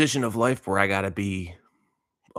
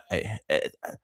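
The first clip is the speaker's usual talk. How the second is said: normal talking voice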